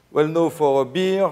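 usual speaking voice

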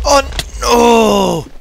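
loud high cry of dismay; dismayed shout. Real, not acted. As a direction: yell as you fall. Falls off the edge.

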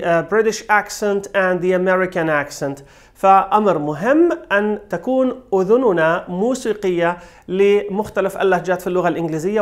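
ordinary delivery

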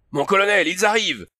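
high, loud alarm; urgent battlefield cry; yelling. A narrator's reading. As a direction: yell a warning to your colonel. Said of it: "hurla Hans".

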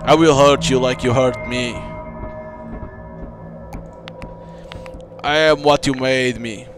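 mournful vengeful ghost voice; ghost bride. Acted. Ghost bride speaks to player.

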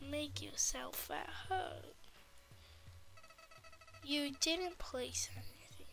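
soft unvoiced whisper to a character. Kid whispering to the shop owner in the game. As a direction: breathe the words, no voice.

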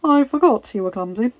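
smirking. A narrator's reading. Man teasing Belle after her slip, smirking. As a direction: tease with an audible smirk. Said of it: "a smirk evident in his voice".

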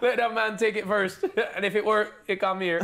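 high-pitched Jamaican impression through laughter; Jamaican accent. Retelling a vaccine speech to mates, imitated Jamaican accent. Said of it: "that was a cold Jamaican accent".

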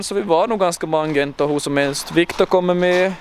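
ordinary delivery

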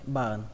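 "button" as ba-ờn run into one. Shown as a demonstration. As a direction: say ba-ờn quickly, no t. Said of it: "các bạn nói nhanh lại". (nói nhanh "ba-ờn", bỏ âm t)

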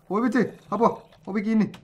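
high startled cries for help; real jump scare, cry out; high yelps, startled by the trap; panicked yelp. Real, not acted. Sudden trap explodes in game.

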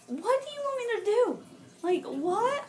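high-pitched retold protest; hammed-up helpless complaint; exasperated. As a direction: plead in mock bafflement. Acted exasperated reply to teacher.

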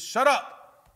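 loud, high shouted order; sharp acted shout of authority; abrupt command. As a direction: boom it out at the storm. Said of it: "He just speaks it".

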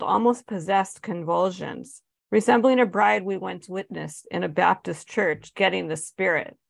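ordinary delivery

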